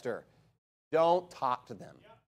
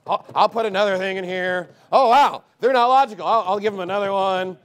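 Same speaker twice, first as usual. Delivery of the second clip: mocking a smug troll; 6 dB louder than usual. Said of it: "They're like".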